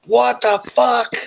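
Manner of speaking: high, slow shocked yell. Acted. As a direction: bellow it out in disbelief. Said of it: "and he yells".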